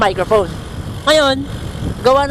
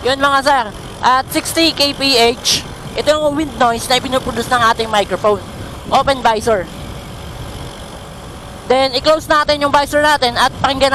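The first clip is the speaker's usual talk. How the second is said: while riding. Real, not acted. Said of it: "subukan nating tumakbo ng naka-open visor".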